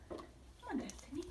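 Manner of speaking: soft near-whisper; hushed coaxing. Real, not acted. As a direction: coax her in a hush.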